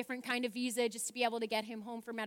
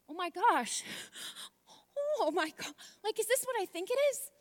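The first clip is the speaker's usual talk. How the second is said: rising excitement; 9 semitones higher than usual. Retold or imitated. Reliving the moment she read the email, voice high with disbelief. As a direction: pitch climbing up into a high, breathless disbelief. Retelling shock at news.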